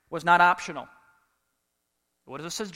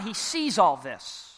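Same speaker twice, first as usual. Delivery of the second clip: ordinary talk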